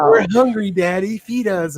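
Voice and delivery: high squeaky puppy voice, begging; hungry puppies. Retold or imitated. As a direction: voice the puppies whining for food. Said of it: "they're like".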